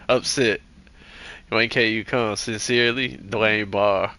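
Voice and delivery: put-on earnest letter-writer in a folksy country accent; folksy country accent, plainly aggrieved. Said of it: "why do I keep pulling this accent out".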